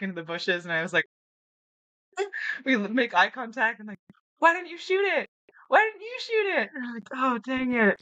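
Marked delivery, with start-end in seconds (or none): 4.4-6.7 very high, exasperated repeated question; incredulous partner. Retold or imitated. Partner baffled she didn't shoot. 7.1-8.0 high, rueful little groan of a phrase; sheepish regret. Retold or imitated. Admitting her missed chance.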